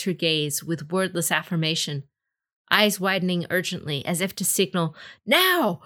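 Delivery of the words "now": high, urgent call; urgent shout. A narrator's reading. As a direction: shout the signal. Signal to strike.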